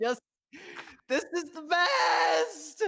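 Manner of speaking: slow, high, loud joyful shout. Acted. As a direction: shout it out with pure joy. Azure exults at freedom.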